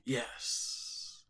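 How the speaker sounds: soft, whispered hiss; sinister spider creature. Acted. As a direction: whisper it greedily as a monster.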